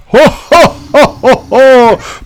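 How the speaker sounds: booming high Santa laugh; hammy, slow Santa-style ho ho; jolly ho ho ho. Pretend. Hammed-up spooky sign-off laugh.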